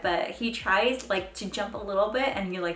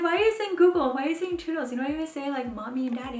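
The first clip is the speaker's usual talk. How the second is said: high-pitched playful complaint; mock exasperation; 7 semitones higher, faster than usual. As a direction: plead with a toddler in pretend dismay. Mock complaint to her toddler.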